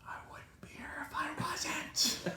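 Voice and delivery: slow, strangled angry whisper; seething whisper. Retold or imitated. Answering the tow lady while holding back fury, seething whisper. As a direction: squeeze the retort out with barely any voice. Retold seething reply to registration question.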